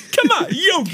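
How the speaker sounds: very high and loud, laughing through it; high Boo Boo voice. Retold or imitated. Friend does Boo Boo, squealing and laughing. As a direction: squeal at Yogi between laughs.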